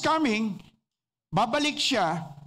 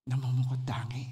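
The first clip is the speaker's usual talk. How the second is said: breathy awed whisper of the phrase; hushed whisper; 12 semitones lower, 4 dB quieter than usual. Shown as a demonstration. Preacher to congregation, awed whisper. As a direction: drop to a reverent whisper.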